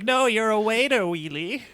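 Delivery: high cartoon voice; correcting cartoon sidekick. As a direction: pitch it up to burst his bubble. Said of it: "Putt Putt's like".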